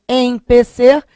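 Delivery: "empecer" with a high, stressed EM; E inicial enfatizado. Shown as a demonstration. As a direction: punch the EM in "empecer". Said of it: "é com E". ("empecer" com EM forte e agudo)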